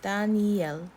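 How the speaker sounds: Daniel as slow da-ni-el; Korean-style pronunciation. Shown as a demonstration. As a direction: say Daniel with open ah and separate el. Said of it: "the E that they have there".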